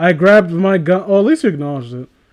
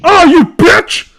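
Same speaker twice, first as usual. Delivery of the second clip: slow, high, loud scream; 11 semitones higher, 6 dB louder, slower than usual. Acted. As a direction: scream it at her slowly. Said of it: "I screamed at her".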